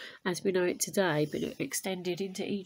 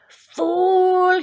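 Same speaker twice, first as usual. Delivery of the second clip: much higher and louder, a furious cry; furious shout; 11 semitones higher, 13 dB louder than usual. Acted. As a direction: hurl the insult at his careless brother. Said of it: "he cried".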